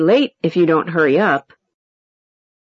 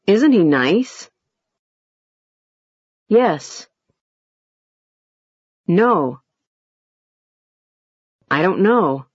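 ends on a low, gentle rising tune; low rise; slower than usual. Shown as a demonstration. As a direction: start low and rise only a little at the end. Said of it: "Low, low rise".

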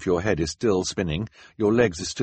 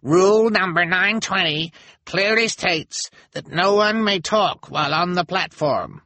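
high, slow, officious voice; mean elder; 12 semitones higher, 5 dB louder than usual. A narrator's reading. Elder citing the rules, slow and officious. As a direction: recite the rule smugly. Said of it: "meanest-looking members".

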